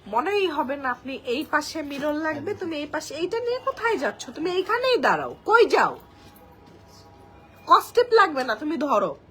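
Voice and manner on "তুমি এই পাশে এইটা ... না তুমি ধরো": much louder and higher, ordering a helper about; calling to helper. Real, not acted. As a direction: call out across the room to someone holding the mirror. Calls out to a helper off-camera. (পাশের লোককে জোরে ডেকে নির্দেশ দেওয়া)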